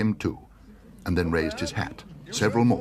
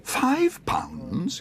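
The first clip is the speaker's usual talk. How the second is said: high, startled question; can't believe the price; surprised exclaim; 11 semitones higher than usual. Acted. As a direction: Paddington aghast at the price. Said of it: "exclaimed Paddington".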